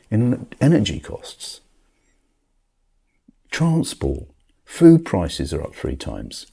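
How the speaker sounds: loud, high exasperated rant; back in my day rant. Real, not acted. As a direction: vent about rising costs, loudly. Rant about rent, prices, gutter.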